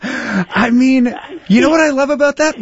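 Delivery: laughing, high and loud; giggling through the words. Real, not acted. Laughing with delight at story.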